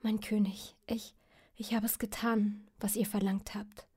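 soft, halting whisper in tears; tearful whisper. Acted. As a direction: whisper it through quiet weeping. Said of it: "kniete er danach ... und weinte".

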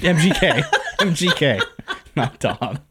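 laughing while saying it; chuckling through the words. Real, not acted. Teasing joke about Dom.

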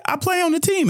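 high, calling out to a bus. Retold or imitated. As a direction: flag down the driver with a high call. Flagging the bus driver down.

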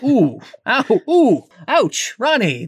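high pained ouch voice; mock pained yelps. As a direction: squeal it out like being squeezed too hard. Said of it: "It's like".

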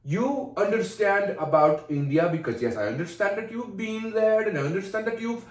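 put-on exasperated Indian accent. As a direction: cut him off in an Indian accent. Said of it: "how good my Indian is".

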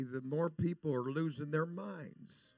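ordinary delivery

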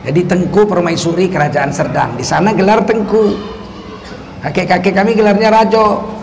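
ordinary talk

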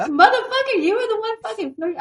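real giggling accusation; laughing accusation. Real, not acted. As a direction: call him out while cracking up.

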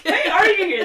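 high, loud mock demand; mock exclaiming. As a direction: echo it in a big high voice. Co-host echoes the mock question.

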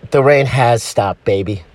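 very low lover's murmur; subdued deep-voiced agreement; low-voiced boyfriend. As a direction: echo your sweetheart in a deep voice. Boyfriend echoes her, low.

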